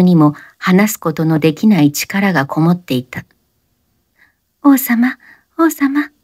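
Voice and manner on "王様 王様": gasping the words high; breathy panting between kisses; half-whispered gasp. A narrator's reading. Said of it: "おしのは唇と唇の間であえいだ".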